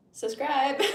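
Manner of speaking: loud, high laughing shout; laughing plea. Real, not acted. Streamer laughing while urging chat to subscribe. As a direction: blurt it through a giggle.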